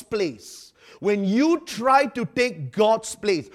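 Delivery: loud, slow, emphatic preacher voice; forceful preaching. Real, not acted. Preacher warns the congregation, slow and loud. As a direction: hammer each phrase out slowly.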